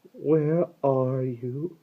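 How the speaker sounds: high, slow singsong call; falsely sweet, drawn-out call; trying to sound pleasant. A narrator's reading. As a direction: call out slowly as if from far away. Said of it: "He was trying to sound pleasant".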